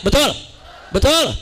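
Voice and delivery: ordinary talk, nothing marked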